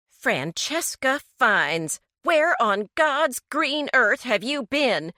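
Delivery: a mother demanding an answer, voice climbing; angry demand. A narrator's reading. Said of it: "she demanded angrily".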